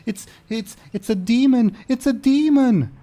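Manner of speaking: high, loud shriek of terror; terrified scream. Acted. Panicking noblewoman spotting the ogre, screaming in terror. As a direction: scream it as if a monster stands before you. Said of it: "lets out a loud scream".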